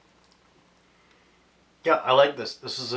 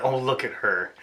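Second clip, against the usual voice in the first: normal talking voice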